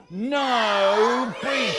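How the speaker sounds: very slow, loud drawn-out command; booming schoolteacher ban; strict teacher. Acted. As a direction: stretch each word into a stern order. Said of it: "Miss says".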